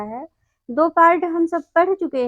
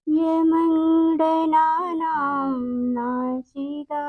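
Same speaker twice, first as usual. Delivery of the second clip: slow, measured chanting of the rule, each syllable drawn out; slow sutra recitation; 3 dB quieter, much slower than usual. Shown as a demonstration. Teacher chanting the Sanskrit rule slowly for children to repeat. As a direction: intone it slowly like a classroom mantra.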